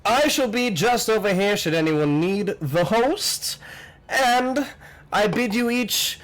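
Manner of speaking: high, fanciful host's greeting; welcoming host, grand. Acted. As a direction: welcome guests with fussy flourish. Voices the party's host.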